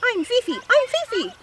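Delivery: very high, loud squeaky introduction; small dog voice. A narrator's reading. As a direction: pipe up like a tiny excitable dog. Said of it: "says the small dog".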